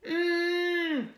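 high, loud hummed wail; distressed muffled cry. Acted. Cucumber in distress in the supermarket, distressed muffled cry. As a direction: cry out through a long "mmm". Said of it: "cried Cucumber".

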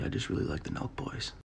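whispered, sheepish confession; whispering the truth out of embarrassment; whispered admission. Acted.

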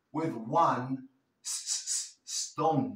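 "stone" started with a bare hissed s, no e before it; pronunciation drill. Shown as a demonstration. Teacher drilling "stone" without an added e. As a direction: drag out the s of "stone". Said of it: "aquí no hay una E".

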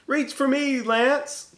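high loud call upward. Acted. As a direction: call out to encourage him. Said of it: "called Beauty".

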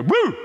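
a sudden high hollered woo; mock scare whoop. Preacher hollering from the pulpit to scare people, a loud woo. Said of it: "I'd get up here every Sunday and go".

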